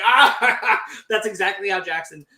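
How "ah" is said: loud, drawn-out mock laugh; high, slow, put-on belly laugh; imitated dwarf laughter. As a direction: throw out a big dwarven guffaw. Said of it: "of the dwarves laughing".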